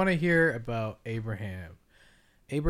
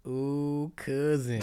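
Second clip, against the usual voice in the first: higher, lightly put-on greeting; playful cousin voice; 4 semitones higher, much slower than usual. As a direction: tease him with the cousin voice. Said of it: "cousin thing".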